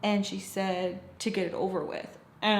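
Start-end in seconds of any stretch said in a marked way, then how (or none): none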